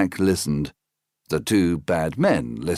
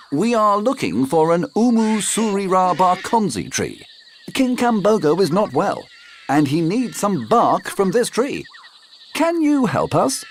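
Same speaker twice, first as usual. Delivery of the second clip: higher, eager uncle; a high, eager storybook uncle; high Uncle Jack voice; 12 semitones higher than usual. Acted. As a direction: pitch up into the friendly uncle.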